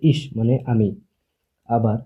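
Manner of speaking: usual speaking voice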